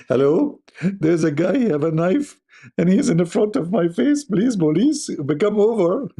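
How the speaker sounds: high, hammed-up call for help; mock panicked caller. Mimicking a victim phoning police, in a high mock-panicked voice. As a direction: pretend to beg the police on the phone.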